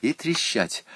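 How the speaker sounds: plain reading voice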